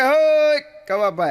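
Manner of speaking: high, loud, slow hail across the street; drawn-out mock call to a passerby; hammed-up friendly hail. Jato hails narrator from porch.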